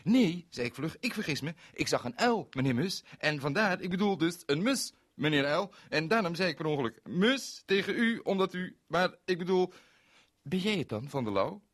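loud, high, tripping over words; embarrassed gabbling; muddled hurried apology; flustered. Acted.

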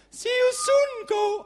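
very high, loud falsetto call; put-on squeaky English goodbye; girlfriend's farewell. A narrator's reading. Girlfriend to departing traveller, loud falsetto farewell.

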